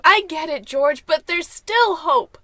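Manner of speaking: loud, slow, pleading hope; loud insistence. Acted. Wife loudly insisting to George there is hope. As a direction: push back loudly but hopeful.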